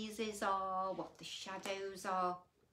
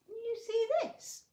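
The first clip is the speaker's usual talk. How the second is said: high, gasping mock surprise; 13 semitones higher, 7 dB louder than usual. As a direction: gasp and point, hammed-up. Gasp showing spooky picture.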